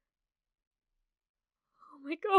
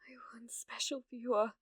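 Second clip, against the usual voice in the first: hushed, softer whisper; whispered devotion to the viewer; soft tender whisper; 6 dB quieter than usual. Acted. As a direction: breathe it like a secret. Intimate confession to viewer.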